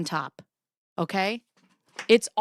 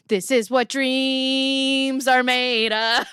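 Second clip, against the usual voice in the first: dreamy declaration; 4 semitones higher, 4 dB louder, much slower than usual. Real, not acted. Declaring her happy life.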